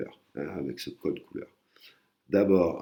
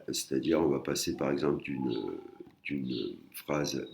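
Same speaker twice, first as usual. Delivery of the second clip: normal talking voice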